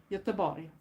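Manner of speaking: Göteborg said the Swedish way, like "YUH-teh-bor"; Swedish pronunciation. Shown as a demonstration. A Swedish vlogger showing viewers how Swedes say Göteborg, in native Swedish pronunciation. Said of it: "or like we Swedes say".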